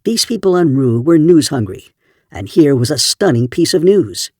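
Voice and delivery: usual speaking voice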